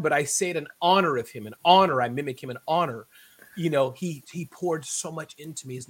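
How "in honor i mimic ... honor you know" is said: ordinary talk, nothing marked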